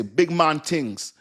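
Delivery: very slow, drawn-out Jamaican imitation; Jamaican accent. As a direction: savour it like a Jamaican saying. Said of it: "you ever hear Jamaican say".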